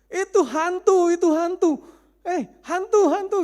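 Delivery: loud, high yelling of ghost; frightened yelling. Retold or imitated. Disciples yelling to each other on the boat, loud high panic. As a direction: yell it in a panic. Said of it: "berteriak-teriak satu sama lain".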